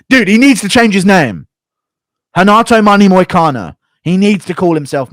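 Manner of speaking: loud, high burst of sudden inspiration; excited outburst. Real, not acted. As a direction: shout the new nickname like an announcement. Delighted by his new nickname idea.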